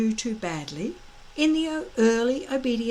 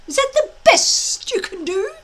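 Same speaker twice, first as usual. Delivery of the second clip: stuttering taunts; 8 semitones higher, 6 dB louder than usual. A narrator's reading. Dragon taunting Fireworm.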